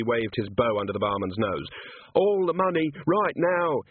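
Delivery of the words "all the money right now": high-pitched robber's yell, moderately raised; hold-up scream kept in check; screamed demand. A narrator's reading. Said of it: "he screamed".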